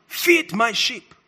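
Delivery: "Feed my sheep" slowly drawn out, in full voice; deliberate command. Retold or imitated. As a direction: proclaim "Feed my sheep" word by word. Repeats the charge to congregation.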